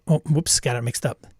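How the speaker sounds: ordinary talk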